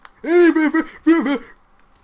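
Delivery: sudden high shrieking laugh, very loud. Real, not acted. Startled by a zombie, lets out a shrieking laugh. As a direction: burst out in a piercing laugh.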